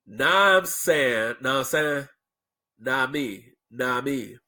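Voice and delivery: "Now I'm saying" squashed into slurred syllables; slurred catchphrases. Shown as a demonstration. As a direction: mumble "Now I'm saying" and "nah me" as run-together slang. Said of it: "I spelled it out phonetically".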